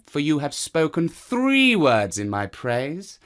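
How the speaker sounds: the smug cat claiming his place. Acted. Cat voiced in the story.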